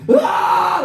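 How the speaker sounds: high comic shriek; mock agonised scream. Bath toy tortured under the tap, high mock scream. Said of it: "Like that".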